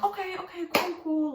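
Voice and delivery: high, hurried retold okay; flustered agreement. Agreeing to go to the hospital, flustered agreement.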